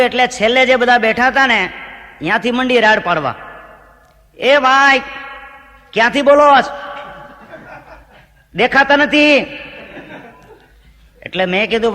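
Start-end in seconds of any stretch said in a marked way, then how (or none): 4.4-9.4 raised, higher call from the back; audience shout. Retold or imitated. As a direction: yell from the back of the crowd. Audience yelling from the back rows. (દૂરથી બૂમ પાડતો શ્રોતા)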